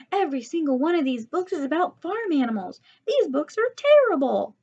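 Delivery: high, loud exasperated complaint; frustrated outburst. A narrator's reading. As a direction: burst out in frustration. Outburst at baby books.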